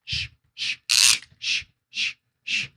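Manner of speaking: drawn hushing shushes, no voice; soft rhythmic shh sounds; shushing. Real, not acted. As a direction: hush them with repeated shh. Shushing the audience.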